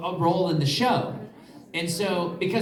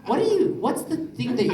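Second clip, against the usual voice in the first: high puzzled director; 5 semitones higher than usual. Retold or imitated. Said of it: "they're like".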